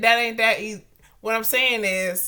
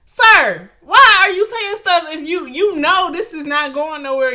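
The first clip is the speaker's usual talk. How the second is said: louder, higher, calling him out; exasperated scolding; 5 semitones higher, 7 dB louder than usual. Acted. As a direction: scold the old man, fed up. Scolding the imagined old man.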